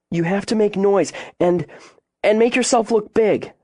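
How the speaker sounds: put-on high, scared child instructing; quivering urgency. A narrator's reading. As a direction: push the instructions out through fear.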